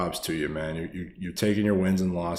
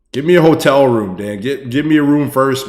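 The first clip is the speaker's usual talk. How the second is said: high, loud playful begging; loud, high joking plea for a hotel room; excited pleading; 6 semitones higher, 14 dB louder than usual. Real, not acted. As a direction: beg the donor, half joking. Begging donor playfully, overexcited.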